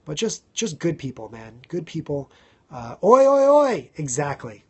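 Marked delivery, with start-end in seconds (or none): 3.0-3.9 loud high chanted oi oi oi; Oi oi oi shout. Pretend. Answering his own Aussie chant, shouting. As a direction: shout the answer like a stadium crowd.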